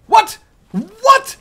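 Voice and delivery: loud high startled cry; shocked shout out of nowhere; startled what. Real, not acted. Gamer startled by an attacker, shouting in surprise. Sudden ambush in game.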